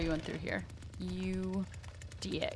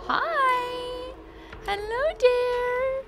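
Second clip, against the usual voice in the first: much higher, slow sugary hello; mock sweet greeting; 13 semitones higher, much slower than usual. Killer greets survivor like a sweet granny. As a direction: coo at the victim like a granny.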